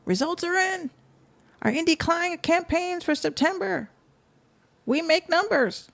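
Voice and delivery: high mock hype-man voice; mocking his post. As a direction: read his boast in a high sneering voice. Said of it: "this is what he posted".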